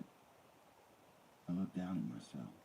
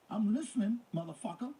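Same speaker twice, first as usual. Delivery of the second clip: menacing; 6 dB louder than usual. A narrator's reading. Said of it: "I said to Goldman".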